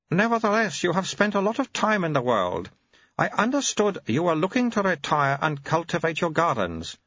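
put-on very high, babyish old abbot voice; an ancient mind in a baby's mouth; high piping infant abbot; baby-bodied old abbot. Abbot continues.

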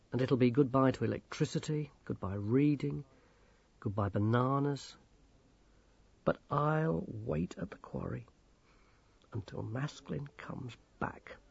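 soft, subdued inner thought; hushed, resigned farewell; bleak resignation. A narrator's reading. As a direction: let it sink away quietly. Grimmer's bleak thought of giving up.

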